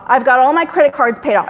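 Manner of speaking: ordinary talk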